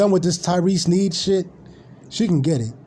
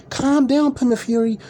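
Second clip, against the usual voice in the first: high, cooing mock-mother voice; higher, sing-song imitation of fans babying a streamer; mocking doting followers; 8 semitones higher than usual. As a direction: coo at him like a fussing mom. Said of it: "they're all saying".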